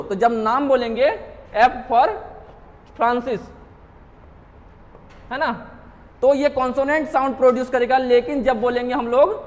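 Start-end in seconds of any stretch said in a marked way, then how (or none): none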